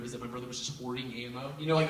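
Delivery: ordinary talk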